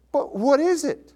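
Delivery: high, drawn-out puzzled question; exasperated question. Acted. Preacher reacting to the accusers' vague answer, exasperated. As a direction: stretch it out slowly, pitched high.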